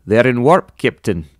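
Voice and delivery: bad Russian accent, loud alert; Chekhov. A narrator's reading. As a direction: report it in a poor imitation Russian accent. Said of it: "Worst Russian accent ever".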